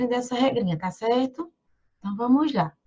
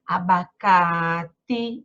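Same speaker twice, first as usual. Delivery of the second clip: very slow, low Abacate with a long CA; a-ba-CAAA-ti, syllables stretched out; calling out the word; 5 semitones lower, much slower than usual. Shown as a demonstration. Calls word to find stress.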